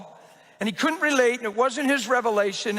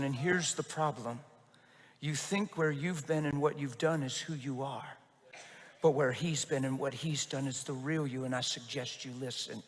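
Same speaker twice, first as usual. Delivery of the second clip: low intense confrontation; 6 semitones lower, 10 dB quieter than usual. Retold or imitated. Pastor confronts man firmly, leaning in.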